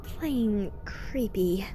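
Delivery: slow, drawn-out disgust; Rarity, creeped out. Acted. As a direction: stretch it out, grossed out. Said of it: "said Rarity".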